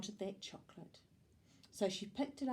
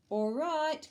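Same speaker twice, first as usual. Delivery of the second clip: higher, louder call; 7 semitones higher, 6 dB louder than usual. A narrator's reading. Amelia Bedelia tells the ladies she is ready, calling out. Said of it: "called Amelia Bedelia".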